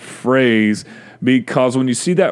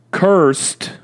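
"Cursed" set apart, high then falling; emphasis on Cursed; 6 semitones higher than usual. Real, not acted. Preacher reading God's words to Adam, "Cursed" emphasized high. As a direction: land on "Cursed" alone, raised in pitch. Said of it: "I'll put the emphasis on the word cursed".

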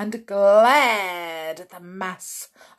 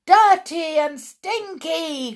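high, loud eager echo; crowd cry; 10 semitones higher, 6 dB louder than usual. Acted. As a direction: cry it back, worked up. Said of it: "cried the audience".

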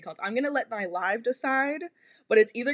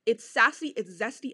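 normal talking voice

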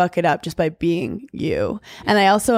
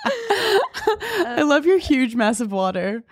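laughing; 7 semitones higher, much slower than usual. Real, not acted. Laughing at friend's big bottle.

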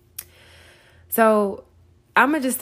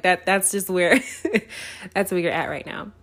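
ordinary talk, nothing marked

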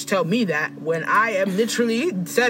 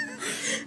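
a shrieking high laugh that cracks; high squealing laugh; 11 dB quieter than usual. Real, not acted. Cracking up at her own South Pole line, high squealing laugh.

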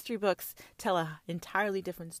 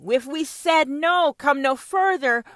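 louder defiant no; 5 semitones higher, 7 dB louder than usual. Acted. Voicing the colonized defiance.

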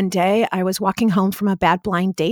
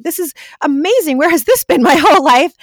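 much higher and louder, bursting with joy, a laugh breaking in; overjoyed; 8 semitones higher, 7 dB louder than usual. Retold or imitated. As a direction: gush with amazed delight. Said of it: "I'm like".

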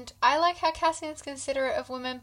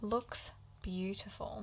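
slow, hushed whisper; awed whisper; 14 dB quieter, slower than usual. Real, not acted. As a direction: whisper it slowly in admiration. Awed at the described tapestry.

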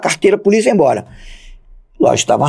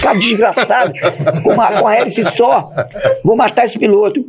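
higher, laughing through a mock threat; laughing curse; 8 semitones higher than usual. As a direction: curse the pilot while cracking up. Laughing while cursing pilot. (xingando o piloto entre risadas)

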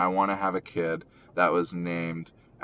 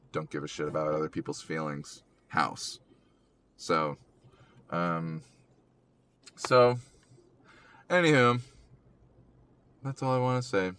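ordinary talk, nothing marked

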